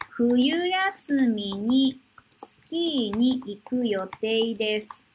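冬休みにスキーに行く read slowly, word by word; slow model reading. Shown as a demonstration. Teacher reading the sentence slowly for students. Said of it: "teacher repeats sentence slowly".

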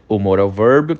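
usual speaking voice

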